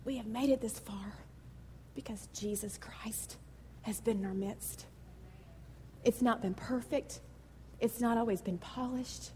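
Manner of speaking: fighting tears. Real, not acted. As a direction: pastor holding back tears before congregation, hushed. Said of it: "I really told God I don't wanna cry".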